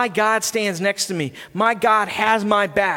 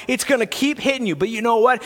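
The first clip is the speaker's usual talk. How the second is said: usual speaking voice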